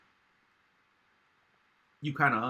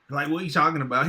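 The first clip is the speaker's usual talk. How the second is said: loud disbelief; 4 semitones higher, 9 dB louder, faster than usual. Real, not acted. Incredulous reaction to Walgreens.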